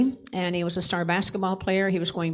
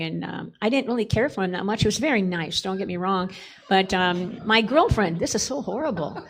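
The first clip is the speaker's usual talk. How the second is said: usual speaking voice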